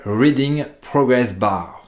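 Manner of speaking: slow, careful English accent on Reading Progress Bar; mock-proud English accent on the plugin name. Shown as a demonstration. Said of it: "Vous noterez mon superbe accent anglais".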